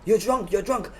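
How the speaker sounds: high-pitched mock accusation; accusing stranger. Stranger insisting his neighbour is drunk, high mock accusing.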